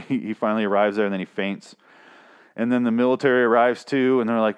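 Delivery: ordinary talk, nothing marked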